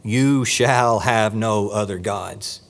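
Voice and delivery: "You shall have no other gods." said slowly and low, every word weighted; a grave, deliberate commandment; slow, weighty command. Shown as a demonstration. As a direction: read it out like a solemn decree. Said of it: "notice how strongly this is worded".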